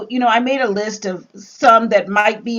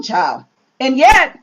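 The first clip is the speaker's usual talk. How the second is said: ordinary talk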